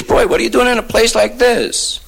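high-pitched incredulous questioning; amazed local. Acted. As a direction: ask in high-pitched disbelief. Local man amazed at finding him.

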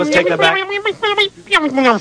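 high slow comic voice. Acted.

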